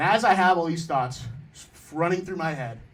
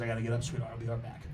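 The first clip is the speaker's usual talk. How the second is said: much softer, lower, tender mother's voice; soft mom; 7 semitones lower, 11 dB quieter, faster than usual. Retold or imitated. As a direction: say it gently like a mom leaving the room.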